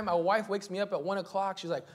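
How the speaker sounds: ordinary delivery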